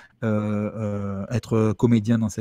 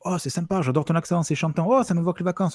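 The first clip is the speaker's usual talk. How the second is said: higher-pitched mock enthusiasm; imitated delighted compliments in a raised voice; gushing admiration; 6 semitones higher than usual. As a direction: gush like a delighted fan, pitched up. Acted fans of southern accents.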